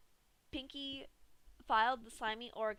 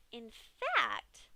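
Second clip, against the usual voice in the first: musing Pinkie; 2 semitones higher, 5 dB louder than usual. Acted. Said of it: "the pink pony mused".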